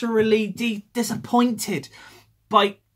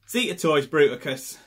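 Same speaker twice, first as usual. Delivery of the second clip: usual speaking voice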